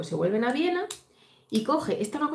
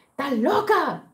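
sudden higher, louder outcry of disbelief; an indignant mock cry of shock; retold outburst; 7 semitones higher, 5 dB louder, much slower than usual. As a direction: blurt it out, shocked and appalled. Sisi reacts to Marie's deception.

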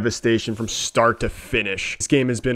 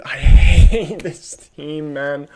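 exasperated laugh; 6 dB louder, much slower than usual. Real, not acted.

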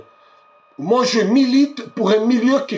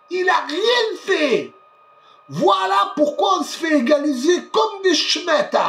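furious yelling; 6 semitones higher than usual. Real, not acted. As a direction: yell at the coach to make changes. Yelling at the coach.

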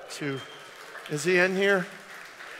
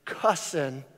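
normal talking voice

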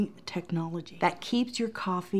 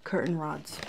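"Curtain rods" low and soft, quicker; quiet echo; 4 semitones lower, 10 dB quieter than usual. Real, not acted. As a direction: drop your voice to repeat "Curtain rods" softly.

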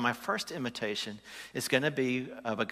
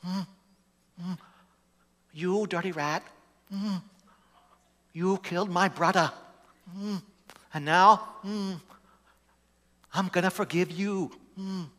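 high clipped gangster voice with humming mm's; Jimmy Cagney; 8 semitones higher than usual. Pretend. Pastor imitating a gangster for the congregation, hammed-up. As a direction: do a hammed-up old movie gangster. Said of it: "So this is Jimmy Cagney".

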